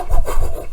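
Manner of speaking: quick puffed punch noises; imitated punches. Showing how he turned and swung, imitated punches. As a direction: mimic a flurry of blows with the breath.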